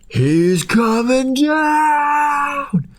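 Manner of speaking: very slow, high, crying shout; wailing panic. Retold or imitated. Gum wailing as the meth head comes down. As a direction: wail it out in terror.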